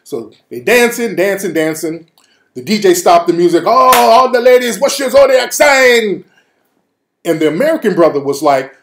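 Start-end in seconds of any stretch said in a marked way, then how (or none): 3.6-6.2 mock DJ hype. DJ on the mic.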